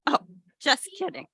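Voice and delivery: ordinary delivery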